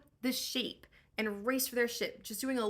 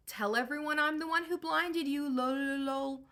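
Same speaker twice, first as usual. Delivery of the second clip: higher, smug bragging; bragging taunt; 5 semitones higher, 3 dB louder than usual. Acted. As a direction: shout the boast back to shore. Odysseus brags across the sea.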